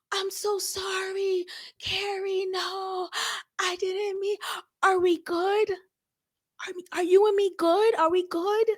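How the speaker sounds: high, soft, anxious apologizing; flustered Miranda. Retold or imitated. Miranda over-apologizing to Carrie, high and breathless. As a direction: gush apologies like a worried friend. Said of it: "Miranda would have been like".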